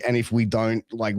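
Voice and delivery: ordinary delivery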